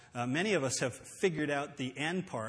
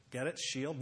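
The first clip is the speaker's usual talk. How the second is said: ordinary delivery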